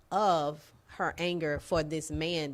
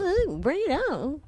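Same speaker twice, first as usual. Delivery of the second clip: very high, loud mock imitation; 11 semitones higher, 5 dB louder than usual. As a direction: tease them by squealing their words up high. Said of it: "He was like".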